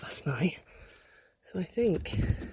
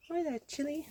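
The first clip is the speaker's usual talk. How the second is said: high coo to a pet; sweet hello to the bird; 8 semitones higher than usual. Real, not acted. Owner greeting Chili the chicken in a high pet voice.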